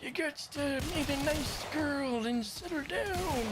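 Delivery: put-on high, slow old-timer drawl; old prospector. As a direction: preach like a folksy old geezer. Old man advising youngins.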